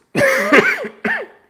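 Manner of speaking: loud cough from a cold; hacking cough, sick. Real, not acted. Speaker has a cold.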